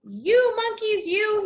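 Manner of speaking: high, slow scolding of the monkeys; scolding peddler. Acted. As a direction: wag a finger and scold them. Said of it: "he said, shaking a finger at them".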